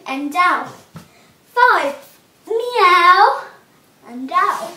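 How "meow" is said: high loud rising meow; a big cat meow. Shown as a demonstration. Teacher counting cat sit-ups with a loud high meow. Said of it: "cat sit-ups".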